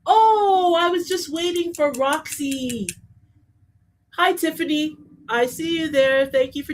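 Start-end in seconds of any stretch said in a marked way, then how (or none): 0.1-2.9 acted gushing welcome. Acting out a viewer who was waiting for Roxy, gushing. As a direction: sing out a long, relieved oh. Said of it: "time for you to say".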